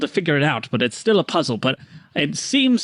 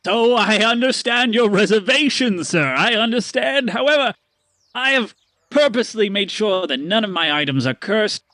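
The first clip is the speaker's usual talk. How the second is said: higher, earnest salesman assurance; reassuring merchant; 5 semitones higher than usual. Acted. Stanley assuring Paxton nothing is cursed. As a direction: soothe the customer's doubts. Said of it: "merchant reassures about curses".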